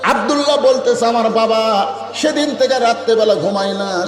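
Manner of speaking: high, ringing sermon chant; impassioned preaching. Acted. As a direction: preach it high, stretching the phrase endings. Preacher at full pitch.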